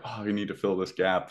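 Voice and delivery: usual speaking voice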